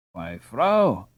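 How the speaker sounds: injured tone. A narrator's reading. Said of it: "exclaimed Ralph in an injured tone".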